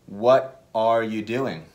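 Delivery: slow, separated What are you doing, every word said in full; careful full form. Shown as a demonstration. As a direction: give each word its own space. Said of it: "las frases se conectan de una forma distinta".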